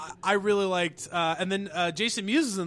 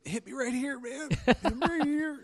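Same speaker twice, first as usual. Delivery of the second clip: mock heartfelt; 7 semitones higher than usual. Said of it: "mock heartfelt moment".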